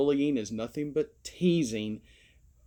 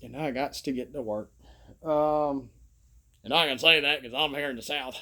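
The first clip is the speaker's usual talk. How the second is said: put-on Southern accent. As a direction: speak like a folksy Southern father-in-law. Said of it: "use my father-in-law's voice, who's real Southern".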